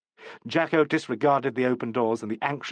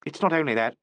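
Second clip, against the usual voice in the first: usual speaking voice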